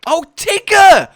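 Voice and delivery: loud, high, drawn-out exclamation; excited outburst. Real, not acted. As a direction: shout with delight at suddenly remembering. Delighted outburst on remembering Tigger.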